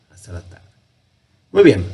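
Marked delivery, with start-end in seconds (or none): 0.1-0.7 whispered. Real, not acted. Whispered repeat to himself.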